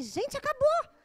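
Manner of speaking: high, loud amused outcry; mock shock. Driver finding the car horn has died, mock shocked cry. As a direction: exclaim it in hammed-up disbelief.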